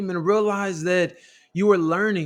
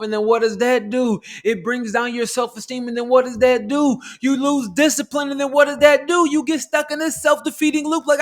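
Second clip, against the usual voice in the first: climbing higher and louder with each question until shouting; building to a shout; 7 semitones higher, 5 dB louder than usual. Acted. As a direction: wind it up step by step into a full preaching shout. Escalating rhetorical chain, self-talk.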